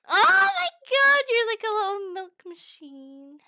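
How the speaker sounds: high loud squeal of delight; excited squeal. Pretend. Streamer squeals at the glue gun dripping, high and loud. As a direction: squeal at how cute it is.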